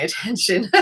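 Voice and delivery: ordinary talk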